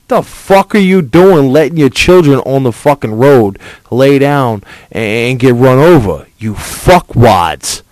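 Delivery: loud angry yelling; furious shouting tirade; angry rant. Real, not acted. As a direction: chew out careless parents. Ranting at parents.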